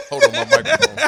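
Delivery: very high, loud helpless laughter; words squeezed out while cracking up; real laughing fit. Real, not acted. Cracks up at accent teasing.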